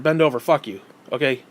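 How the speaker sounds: ordinary delivery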